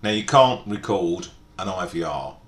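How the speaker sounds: ordinary delivery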